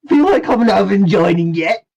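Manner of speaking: high, loud, fast villain voice; gleefully goading villain, pitched high; smiling villain taunt. Acted. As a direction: taunt them through a delighted smile. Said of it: "with a lighted smile, he goes".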